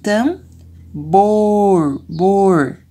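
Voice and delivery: TAMBOR split up, TAN... BOOOR, BOOR; drawn-out vowel. Shown as a demonstration. Teacher sounding out the vowel, stretched BOR in TAMBOR. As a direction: hold the O of BOR long. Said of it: "O O".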